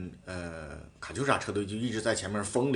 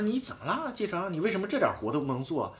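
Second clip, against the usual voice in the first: high-pitched retold complaint, a touch of irritation; annoyed reproach; 6 semitones higher, 4 dB quieter than usual. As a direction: imitate a teammate's irritated question. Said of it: "他就有点生气了". (模仿队友不满的质问)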